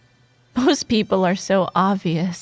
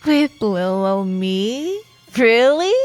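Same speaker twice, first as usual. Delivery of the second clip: slow, drawn-out mock innocence; playfully incredulous; teasing disbelief; 4 dB louder than usual. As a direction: stretch it coyly, rising at the end. Teasing a nervous coworker.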